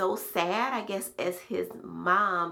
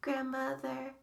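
sweetest voice; 9 semitones higher, 6 dB quieter, slower than usual. Retold or imitated. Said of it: "in the sweetest voice".

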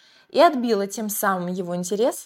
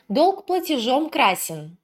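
slow, weighty and high; meaningful; 6 semitones higher, 3 dB louder, slower than usual. A narrator's reading. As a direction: say it slowly with weight. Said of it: "пристально глядя в глаза юноши". (весомо и медленно)

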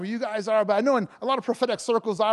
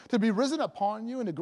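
ordinary talk, nothing marked

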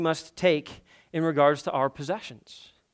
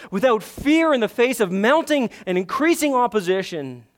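raised, louder preaching voice, driving each line; fervent pulpit delivery, higher and louder; preaching at full force; 5 semitones higher, 5 dB louder than usual. Acted. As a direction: preach it hard to the congregation.

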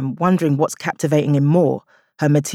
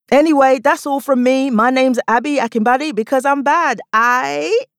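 bright, higher announcer sign-off; stage sign-off; 9 semitones higher than usual. Acted. Comic signing off to the crowd, higher upbeat sign-off. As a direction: close the set with a flourish.

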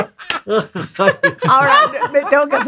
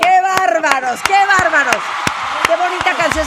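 amazed praise; 6 semitones higher than usual. Real, not acted. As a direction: gush over them. Praising the singers.

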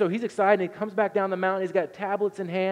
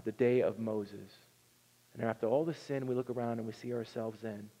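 ordinary talk, nothing marked

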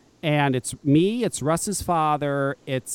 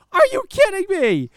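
incredulous outburst; 12 semitones higher, 4 dB louder than usual. Real, not acted. Outraged reaction to the ad.

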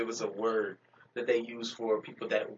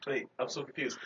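ordinary talk

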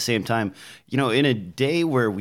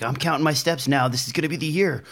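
higher, eager mock coworker voice; mock resolution-maker; 6 semitones higher, faster than usual. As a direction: imitate an overconfident dieter. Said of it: "people with Fitbits at the office".

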